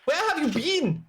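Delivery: put-on high, indignant German-accented demand; German-accented captain. Captain questioning his man, indignant German accent. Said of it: "that was a German accent".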